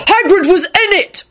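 high, slow outraged roar; acted unhinged fury; deranged roar. A narrator's reading. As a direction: roar each word in horror. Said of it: "Neville roared".

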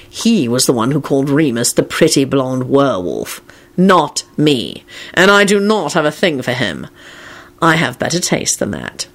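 low, haughty denial; cold. A narrator's reading. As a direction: state it with icy dignity. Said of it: "Kingsley announced coldly to Ginny".

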